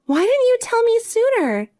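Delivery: dismayed outcry. Acted. Sister cries out in dismay.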